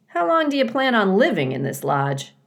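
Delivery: a hint of a chatty beaver; lightly voiced beaver. A narrator's reading.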